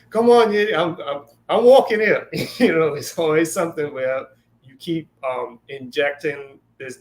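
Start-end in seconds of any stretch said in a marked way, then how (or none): 0.1-2.3 high, loud mock yell at a driver; hammed-up street yell. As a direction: shout it like a cabbie cut off in traffic. Acted New York style complaint.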